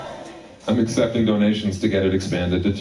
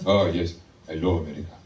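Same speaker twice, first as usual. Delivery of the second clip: deliberate, lilting mock Zimbabwean accent. Clerk tells a customer of his dream, in a Zimbabwean accent.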